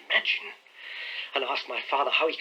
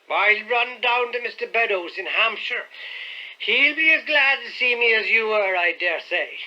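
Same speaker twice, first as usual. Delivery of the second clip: put-on thick, slurred drunk voice, higher and louder; boozy insolent butler; half-drunken man; 8 semitones higher, 6 dB louder than usual. A narrator's reading. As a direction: sneer it out like a drunk servant. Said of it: "in the thick voice of a half-drunken man".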